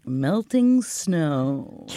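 very slow, drawn-out poetic aside; slow dreamy voice. Pretend. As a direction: say it like a slow poem.

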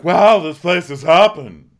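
loud, high exclamation, stretched out; mock a big exclamatory arrival remark; hammed-up booming wonder; Luann. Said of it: "a exclamatory remark".

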